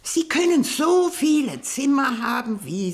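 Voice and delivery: spring the offer on him loudly; old lady abruptly and loudly offering all the rooms; sudden loud offer. Acted.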